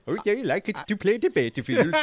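slow, high mock Swedish accent; hammed-up Swedish lilt, drawn out. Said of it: "I'm already an expert at it".

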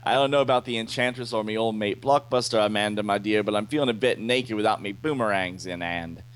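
badly faked Aussie rogue; hammed-up Australian patter; bad Australian accent. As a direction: put on a clumsy Australian accent. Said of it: "My Australian accent still sucks".

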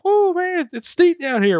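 high, loud shout as the trail drops; startled yell. Retold or imitated. Mountain biker shouting about the steep trail, loud and high. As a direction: yell it out, losing control. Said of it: "like, he, like, yell".

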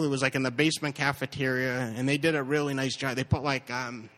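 ordinary talk

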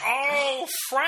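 surprised realization. Retold or imitated. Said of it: "we all looked at each other like".

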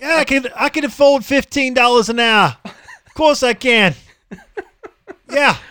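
loud, high mock Australian accent; hammed-up Aussie with a dash of London. Podcaster mocks an Australian employer, broad Australian accent. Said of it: "mixture between London and Australian".

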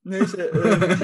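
gülmesini bastırarak; kahkahayı tutmaya çalışarak; gülmemeye çalışarak. Real, not acted. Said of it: "Gülmekten konuşamadım". (gülmemeye çalışarak)

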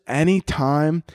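ordinary delivery

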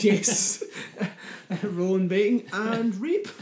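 laughing, high and loud; slow words through laughter. Real, not acted.

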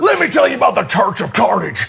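hollered southern preacher pitch. Pretend. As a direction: sermonize as a scratchy villain preacher. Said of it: "You sound like a preacher".

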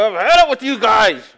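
a hint of God slamming the door; preacher voicing God done with Israel; fed-up God. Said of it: "This is Isaiah talking to God here".

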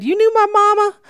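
high, loud disbelief; surprised question. Retold or imitated. Asking Charles in astonishment whether he knew her mama. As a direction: pop up in sudden surprise. Stranger says he knew mother.

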